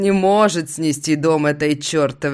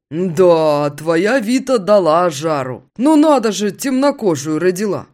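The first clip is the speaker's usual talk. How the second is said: loud, raised friend voice over music; friend exclaiming; 4 semitones higher, 6 dB louder than usual. Acted. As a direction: call it out over the bar music. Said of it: "Сквозь музыку восклицает Витя".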